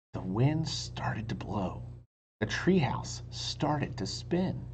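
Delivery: whispered story reading, slowing at the end; hushed narration. A narrator's reading. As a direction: build suspense in a whisper. Narrates the time jump hushed.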